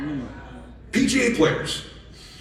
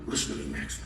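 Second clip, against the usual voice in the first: intense whisper; 12 dB quieter, faster than usual. Retold or imitated. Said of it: "I go".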